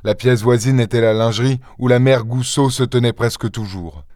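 usual speaking voice

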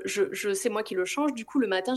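usual speaking voice

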